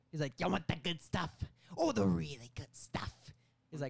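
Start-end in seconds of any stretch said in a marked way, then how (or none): 0.4-3.1 loud, higher-pitched dealer impression; Willem Dafoe. Meth dealer offering the good stuff, hammed-up Willem Dafoe. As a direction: tempt them like a theatrical drug dealer. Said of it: "Willem Dafoe says".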